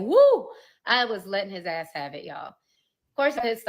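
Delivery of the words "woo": loud, very high exclaimed woo; sharp high holler; whoop. Real, not acted. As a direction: belt out an exasperated whoop. Exclaiming mid heated retelling.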